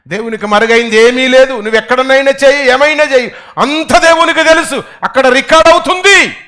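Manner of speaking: loud, high, rising intensity; fiery preaching. Real, not acted. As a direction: thunder it from the pulpit. Preaching at full force. (ఉద్రేకంగా బోధించడం)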